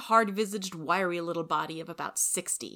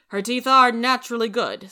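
a touch louder, brisk neighbourly Marilla; 6 semitones higher, 11 dB louder than usual. Acted. Marilla greeting Rachel at her door, brisk and loud.

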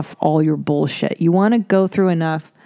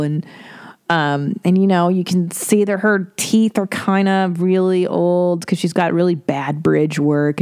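normal talking voice

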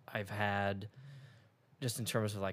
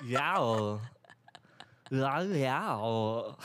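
higher, drawn-out comic double impression; Christopher Walken doing Arnold Schwarzenegger; 3 semitones higher, 4 dB louder than usual. Showing off a layered impression, Walken as Schwarzenegger. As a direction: exaggerate one star imitating another. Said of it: "Christopher Walken doing Arnold Schwarzenegger".

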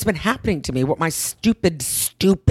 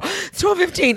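much higher pitch, shocked disbelief; dismayed surprise; 13 semitones higher than usual. Retold or imitated. Retelling waking at night, shocked and high. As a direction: squeak it out in horror at the clock.

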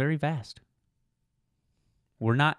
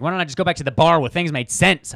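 louder, higher put-upon grumble; whiny Bobo voice; 6 semitones higher, 9 dB louder than usual. Retold or imitated. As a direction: voice a fed-up sidekick. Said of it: "It's like".

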